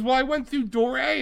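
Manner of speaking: high, whiny imitation of the customer; mock whiny woman. Retold woman, whiny answer.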